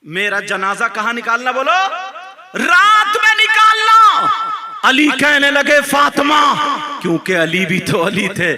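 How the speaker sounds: loud, ringing sermon delivery; impassioned preacher in full voice; full-force preaching. A narrator's reading. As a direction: declaim the story and its questions to the crowd.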